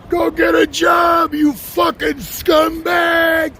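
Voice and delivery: high, loud, slow mocking yell imitating a driver; slow, loud shouted jeer; yelled insult. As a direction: bellow it like a rude driver passing by. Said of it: "He yells at me".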